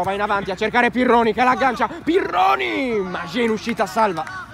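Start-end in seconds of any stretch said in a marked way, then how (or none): 1.9-3.0 slow high call of the name; drawn-out name call. Real, not acted. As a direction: stretch the name out. Player's name called out on attack. (nome gridato)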